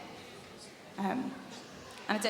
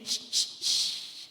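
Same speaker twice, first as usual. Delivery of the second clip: shh demo; much slower than usual. Shown as a demonstration.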